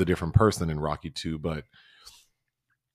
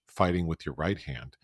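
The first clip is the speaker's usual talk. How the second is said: normal talking voice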